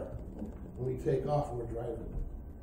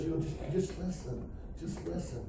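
soft, hushed instruction; gentle counsel; 7 dB quieter than usual. Retold or imitated. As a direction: quietly settle someone down. God quietly telling him to listen.

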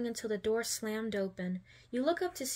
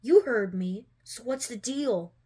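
louder, hard-edged bully; aggressive retort; 6 dB louder, slower than usual. A narrator's reading. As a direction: push back, blunt and slow.

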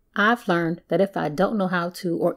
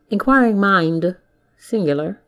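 normal talking voice

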